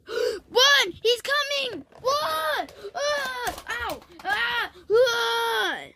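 high-pitched screams of alarm; pretend panic. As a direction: cry out as if being chased. Pretend flight from monster.

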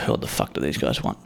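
imagined onlooker muttering, low and hushed; quiet, deep under-the-breath grumble; low aside. Acted. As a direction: drop right down in pitch and volume. Imagined onlooker sizing them up.

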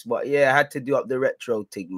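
ordinary talk

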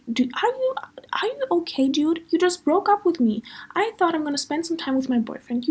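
high-pitched, disbelieving protest; retold incredulous outrage. Retelling the reply to the boyfriend who just dumped her, incredulous. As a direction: act out indignant disbelief, pitch jumping up.

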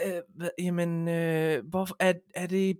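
quiet, quavering fluster; flustered whimper. Retold or imitated. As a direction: mimic an overwhelmed, anxious man. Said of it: "det kunne han slet ikke overskue".

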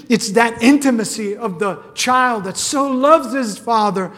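loud, impassioned preaching at full force; fervent preaching. Acted. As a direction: cry out to the Lord with full conviction. Preaching climax on approval.

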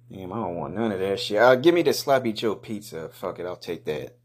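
ordinary talk, nothing marked

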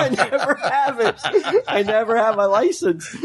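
high, helpless laughing confession; amused, laughing as they speak; laughing admission. Real, not acted. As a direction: admit it through laughter. Laughing admission after a tease.

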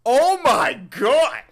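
loud, high, drawn-out outcry; appalled high shout; shocked outburst. Real, not acted. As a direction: cry out in horror, stretching each word. Reacting to shown film, outburst.

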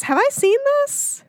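high, slow puzzled voice; confused friend voice. Retold or imitated. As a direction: play it dazed and unsure. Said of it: "And Erica was like".

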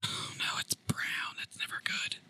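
hushed dread whisper. Real, not acted. Dreading the brown jelly bean.

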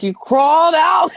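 slow, high, loud yell; coach yelling. Retold or imitated. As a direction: bellow it at the player. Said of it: "He's like".

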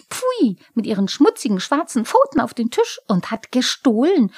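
high, loud, ranting little kobold; squeaky aggrieved tirade; indignant kobold. A narrator's reading.

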